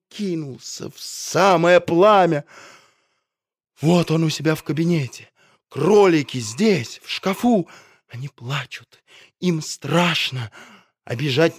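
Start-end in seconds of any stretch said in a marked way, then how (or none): none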